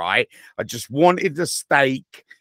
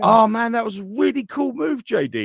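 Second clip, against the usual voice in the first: very high, wide-eyed fanboy excitement; excited wrestling fan; 11 semitones higher, faster than usual. Acted. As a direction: gush like a thrilled fan, hammed-up. Acting himself as thrilled fan.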